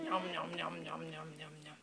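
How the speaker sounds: soft munching noises; playful nom noms. Pretend. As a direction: pretend to gobble. Mother mimics eating sounds.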